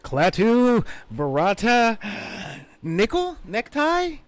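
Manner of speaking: a high, loud hammed-up spell chant; mock incantation. Drunk wizard botching his magic words, high and loud. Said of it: "And he says the words".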